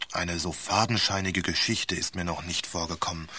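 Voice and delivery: plain reading voice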